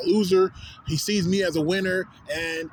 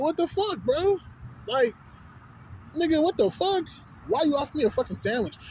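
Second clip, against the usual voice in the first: much higher, indignant questioning; incredulous outrage; 10 semitones higher than usual. Retold or imitated. Man demanding why a stranger offered him a sandwich, incredulous. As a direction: pitch jumps up in baffled protest.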